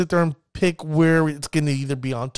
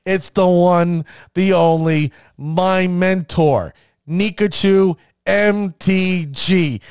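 slow, loud build to the big name; hype announcement; 3 dB louder, slower than usual. Real, not acted. Introducing his mentor to viewers, slow and loud. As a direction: drag out each phrase like a ring announcer.

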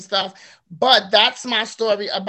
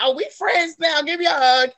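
higher-pitched, playful retold welcome; imitated happy greeting; 6 semitones higher, 3 dB louder than usual. Guest imitates future husband happy to see her, delighted. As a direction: act out his delighted greeting.

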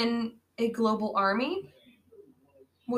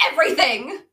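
loud, slightly raised retold shout; imitated dramatic yell; mock yell; 3 semitones higher, 7 dB louder, faster than usual. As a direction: call it out like a yelling ninja. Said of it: "and yells".

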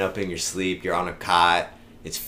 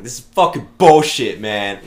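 loud, higher retold protest; mock outrage; 4 semitones higher, 13 dB louder than usual. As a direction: blurt out an indignant complaint, played for laughs. Retold protest to head scout.

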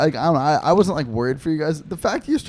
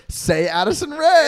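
high, slow singsong mom voice; a mom cooing at kids to smile for the camera; cheesy photo mom; 12 semitones higher, 3 dB louder, slower than usual. Retold or imitated. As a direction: drag out the name like a mom posing a photo. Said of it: "the mom's like".

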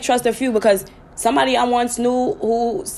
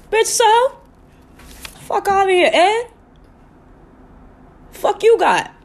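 mock retort; 12 semitones higher, 3 dB louder, slower than usual. Mocking someone's words, high.

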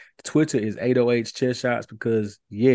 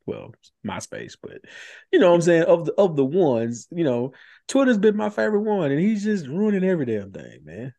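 heated, higher pitched rant; worked-up rant; 6 semitones higher than usual. Real, not acted. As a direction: vent about it, fired up. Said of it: "I went off on a tangent".

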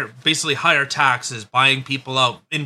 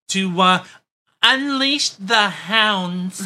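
slow, higher-pitched mock German voice; German accent; 4 semitones higher, much slower than usual. Co-host voicing Trudeau ordering police out, mock German accent. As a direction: drag it out in a hammed-up German accent. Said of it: "in my best German voice".

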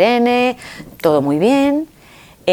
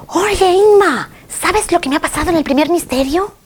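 excited Mexican woman; 12 semitones higher, 3 dB louder than usual. Retold or imitated. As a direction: call out to her friend, thrilled to share. Imita a la mexicana emocionada. (la mexicana, muy aguda y emocionada, llamando a Inma)

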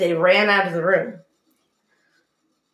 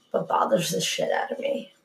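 whisper; 7 dB quieter than usual. Real, not acted. Voice drops to near whisper.

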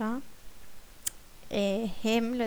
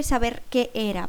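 ordinary talk, nothing marked

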